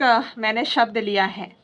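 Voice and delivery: normal talking voice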